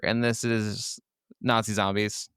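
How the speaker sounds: ordinary talk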